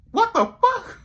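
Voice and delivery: very high, loud outburst; shocked shriek. Retold or imitated. Dave seeing the baby at birth, shrieking in shock. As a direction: shriek it in disbelief. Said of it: "he's like".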